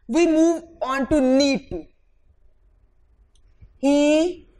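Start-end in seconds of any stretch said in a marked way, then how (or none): 3.8-4.5 drawn-out classroom example; spaced words for students; slow dictation. Shown as a demonstration. Teacher dictates example sentence.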